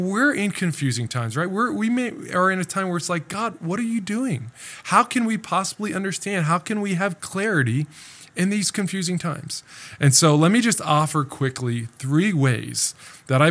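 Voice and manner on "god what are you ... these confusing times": crying out to God. Acted. Believers voiced crying out to God.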